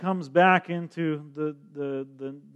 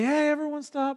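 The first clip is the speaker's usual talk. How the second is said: mock yell; 11 semitones higher than usual. Preacher mimics Jesus yelling to halt the procession. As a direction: yell it like stopping a funeral. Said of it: "He doesn't yell and say".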